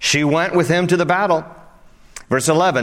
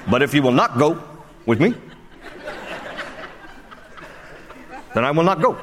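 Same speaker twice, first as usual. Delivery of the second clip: loose politician impression, long comic pauses; Barack Obama; 4 dB quieter, faster than usual. Preacher milks a bad Obama impression for laughs, halting. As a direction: break the verse into halting phrases, lightly imitated. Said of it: "not a very good Barack Obama imitation".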